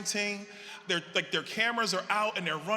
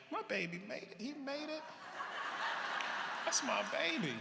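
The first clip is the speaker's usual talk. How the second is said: higher, softer imitation of a proud mother; proud mom; 8 semitones higher, 5 dB quieter than usual. Son retells his proud mom's reaction, gushing mock mother voice. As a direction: lightly voice mom gushing over her son.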